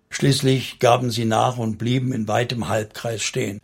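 usual speaking voice

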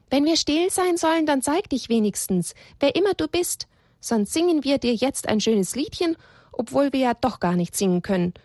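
a touch of bold sauciness; cheeky call. A narrator's reading. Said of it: "rief Elia ganz frech".

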